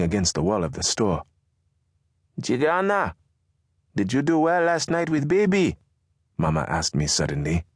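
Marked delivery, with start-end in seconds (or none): 2.4-5.8 higher, brisk mother voice. Acted. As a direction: question the son as his mother. Narrator voices Mama.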